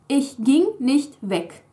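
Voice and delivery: Ich ging nicht weg said slowly, weg at the end; slow model sentence. Shown as a demonstration. Teacher modelling Präteritum, slow. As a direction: read it out carefully. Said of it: "This happens in the Präteritum too".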